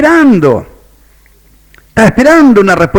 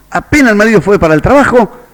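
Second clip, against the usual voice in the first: ordinary talk, nothing marked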